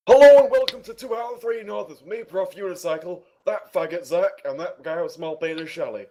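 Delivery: loud, high announcer intro. Acted. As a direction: boom out the show intro like a host. Podcast intro take.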